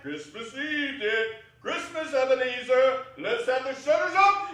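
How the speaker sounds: high, loud merry calling out; booming festive shout; jolly cry. Acted. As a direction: rouse the lads for the holiday. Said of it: "cried old Fezziwig with a sharp clap".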